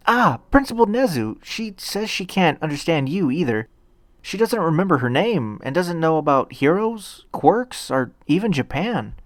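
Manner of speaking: put-on a nervous, kind boy hero; eager young boy Deku. A narrator's reading. Deku reports to principal.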